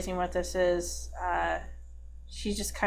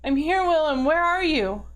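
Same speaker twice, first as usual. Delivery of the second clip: high, louder plea to be found; desperate calling; 7 semitones higher, 5 dB louder than usual. Acted. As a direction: call out anxiously to a lost friend. Character calls to lost friend.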